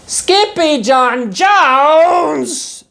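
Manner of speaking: high, loud, very slow stretched cry; cried. A narrator's reading. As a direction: call it out long and high. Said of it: "everyone cried".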